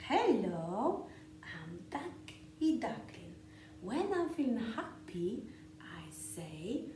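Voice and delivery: lively, sing-song picture-book read-aloud with whispered bits; reading to children. A narrator's reading.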